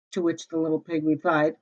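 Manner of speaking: ordinary delivery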